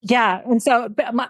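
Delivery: usual speaking voice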